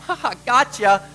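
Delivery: teasing, laughing gotcha; playfully mock the scared disciples; jokey imitated laugh at the frightened friends; mock laughing tease. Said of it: "Jesus just starts laughing at them".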